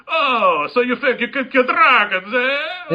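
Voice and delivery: high, loud mocking exclamation; Fletcher. Shopkeeper scoffs at dragon-killing customer, loud mock incredulity. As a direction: scoff with a rising, hammed-up "eh". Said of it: "that fucking Fletcher".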